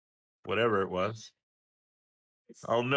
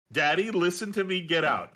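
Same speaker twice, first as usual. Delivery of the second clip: put-on lightly raised, bossy toddler voice; imitating daughter; 5 semitones higher, 3 dB louder than usual. Father quoting daughter telling him to get out, a hint of bossy child. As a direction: order daddy out, childlike. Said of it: "That's what she's telling me".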